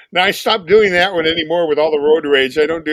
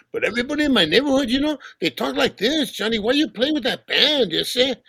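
higher, fast Chicano neighborhood accent; barrio accent; 8 semitones higher than usual. Shown as a demonstration. Showing how his neighborhood talked, Chicano accent. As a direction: talk like the guys in his barrio.

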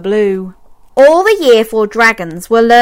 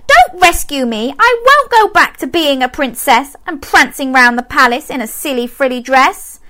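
princess crying stop; 7 semitones higher than usual. A narrator's reading. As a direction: plead firmly with both.

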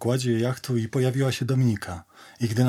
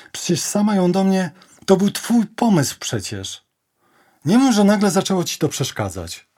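high, loud, protesting; agitated reproach; 8 semitones higher, 5 dB louder than usual. Acted. Pleading with Agnieszka who turns away, agitated reproach. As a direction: push back with rising exasperation. Accusing Agnieszka of changing her mind.

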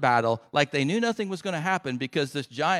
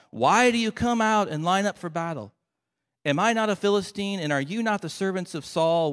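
loud, raised-pitch battle challenge; shouted challenge; 6 semitones higher, 5 dB louder than usual. Acted. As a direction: call it out to the enemy ranks. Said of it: "Goliath stood and shouted".

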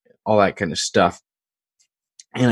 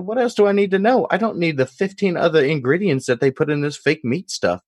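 put-on higher-pitched Deep South country accent; [name]'s Deep South accent; 9 semitones higher than usual. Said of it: "that's the accent".

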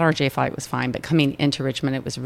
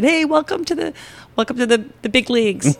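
teasing greeting; 10 semitones higher, 4 dB louder than usual. Retold or imitated. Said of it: "I said".

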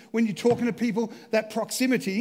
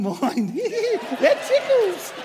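real giggles; laughing; 2 semitones higher, 4 dB louder, much slower than usual. Real, not acted. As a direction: break into ticklish laughter. Son standing very close.

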